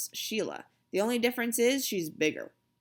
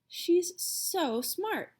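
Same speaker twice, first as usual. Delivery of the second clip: admiring mother; 9 semitones higher, much slower than usual. A narrator's reading. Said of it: "my mother says".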